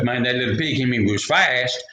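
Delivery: ordinary delivery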